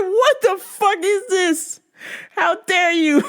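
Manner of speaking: high, laughing mock outrage. Yelling at the dark iPad in mock outrage. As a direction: squeal it in fake betrayal. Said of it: "I was like".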